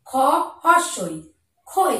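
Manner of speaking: ordinary talk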